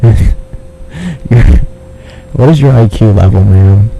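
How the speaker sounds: usual speaking voice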